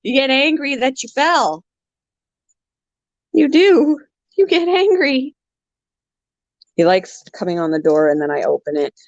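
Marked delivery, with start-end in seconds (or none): 0.0-5.3 high teasing pet voice; sing-song pet scolding. Real, not acted. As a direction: tease the bird up high. Talking to bird.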